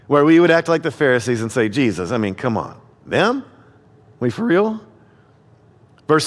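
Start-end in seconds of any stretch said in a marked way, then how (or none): 1.7-4.8 quiet, disbelieving complaint; incredulous scorn. Retold or imitated. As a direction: scoff at the idea of them. Mocking disbelief at "those people.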